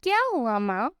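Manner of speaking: lightly teasing question, voice kept low; a touch of playful mockery. Son teasing his mother in the kitchen, mild joking tone. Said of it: "अपनी माँ से मजाक में कहता है".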